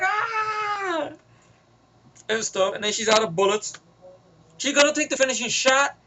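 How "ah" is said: high, far-off yelp of pain. Retold or imitated. Villain hit by bullets, high yelp. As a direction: yelp like he's been shot. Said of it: "He's like".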